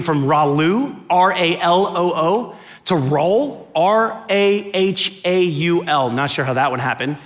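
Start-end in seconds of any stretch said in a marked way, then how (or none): 1.1-2.6 slow letter-by-letter spelling; spelled out. Retold or imitated. Speaker reading a coffee cup misspelling, spelled slowly. 3.7-6.1 slow, drawn-out spelling letter by letter; spelled out. Retold or imitated. Speaker reading another misspelling, spelled slowly.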